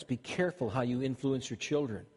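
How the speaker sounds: usual speaking voice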